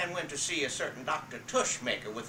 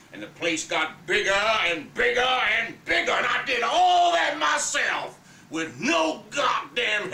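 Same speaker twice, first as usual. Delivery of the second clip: furious shout; 7 semitones higher, 8 dB louder than usual. Acted. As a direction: roar it at her with full rage. Big Daddy boasting, furious.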